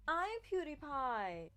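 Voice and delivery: hammed-up big reveal, stretched out; grand reveal. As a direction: announce yourself with drawn-out flourish. Grand reveal in acted dialogue.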